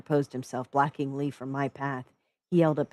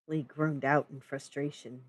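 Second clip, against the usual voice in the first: plain reading voice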